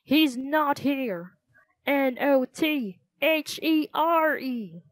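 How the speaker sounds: slow, high, exasperated spelling-out; shouted. A narrator's reading. Frantic theater owner spelling it out for children, mock shouting. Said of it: "shouted Mister Dewey".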